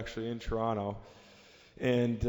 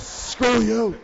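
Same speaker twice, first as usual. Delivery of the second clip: loud, higher mock yell; angry retold swearing; 11 semitones higher, 10 dB louder than usual. Imitating the teammate swearing at him after the goal, angry mock shout. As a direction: imitate a teammate cursing you out. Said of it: "starts swearing at me".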